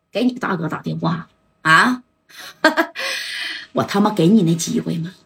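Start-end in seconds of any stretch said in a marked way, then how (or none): 2.6-3.7 high scornful laugh; sneering laugh at the idea of a phone call; mocking laugh. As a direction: laugh him off contemptuously. Liu Yong mocks the plea.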